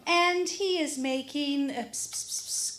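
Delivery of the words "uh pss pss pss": cat-calling pss. Retold or imitated. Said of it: "cat noises".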